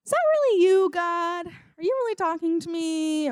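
high, hesitant mock doubt aimed at God; doubtful questioning. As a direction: ask it high and unsure, easing down.

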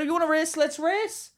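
high, soft-voiced goading; retold challenge. Driver challenging young man at the lights, goading. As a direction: egg him on, pitched right up but quiet.

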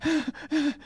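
sharp, very high gasp; tempted gasp. Retold or imitated. Boy in the pew fighting the urge to pull the trigger, gasping. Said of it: "And I'm going".

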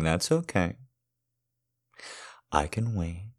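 usual speaking voice

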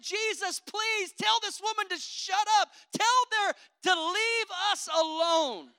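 lightly voiced annoyed disciples; irritated disciples. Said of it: "they were irritated by her".

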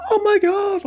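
high excited squeal of delight; oh my God. Retold or imitated. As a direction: squeal it in shock and joy. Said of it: "and be like".

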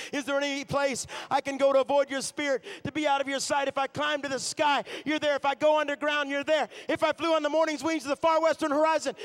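loud, high psalm proclaimed to the crowd; fervent preaching. Acted. The preacher proclaims Psalm one thirty-nine to the church, loud.

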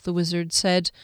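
plain reading voice

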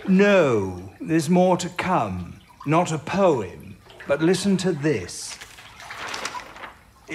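ordinary talk

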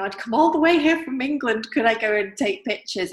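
put-on over-polite English tourist in a British accent; best British accent. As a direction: lay on a polished British accent to charm. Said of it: "my best British accent".

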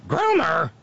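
catchword shrieked in mockery. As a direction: squawk the one word. Mocking the politician's shrieked catchword.

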